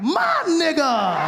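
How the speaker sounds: drawn-out, high, delighted cheer; sudden embrace. Retold or imitated. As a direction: stretch it out like a proud buddy. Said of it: "they were like".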